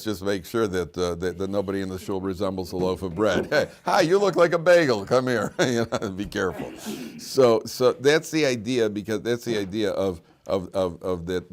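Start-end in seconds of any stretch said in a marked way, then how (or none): 3.5-5.5 higher, louder, eager comic call; hungry mock greeting. Congregant eyeing a bread-like man calls him over, hammed up.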